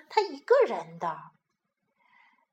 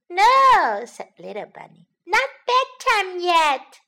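put-on child refusing; 7 semitones higher, 8 dB louder than usual.

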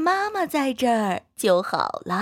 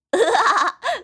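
loud, high giggle; children laugh together; high burst of laughter; 9 semitones higher, 4 dB louder than usual. Acted. Classmates laugh at Tiantian's corn cake tears. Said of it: "大家都笑了".

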